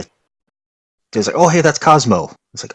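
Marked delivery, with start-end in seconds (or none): 1.4-2.3 higher, louder mock-excited spotting; quoted player's pleased surprise; imitated excited recognition. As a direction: act out spotting someone famous, pitched up. Voices players spotting known names.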